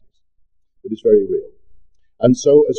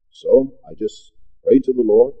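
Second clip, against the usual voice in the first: ordinary talk, nothing marked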